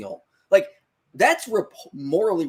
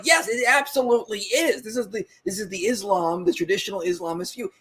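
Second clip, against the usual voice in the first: impassioned retort, higher pitched; 7 semitones higher than usual. Retold or imitated. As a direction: argue it back with conviction. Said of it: "I appreciate your passion".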